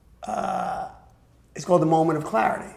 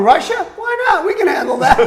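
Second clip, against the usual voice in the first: higher, louder hammed-up bravado breaking into laughs; mock reckless execs; 11 semitones higher, 6 dB louder than usual. Mocking drugged-up music bosses, hammed-up and laughing. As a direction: lampoon stoned bosses deciding on a whim. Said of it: "they're sitting around going".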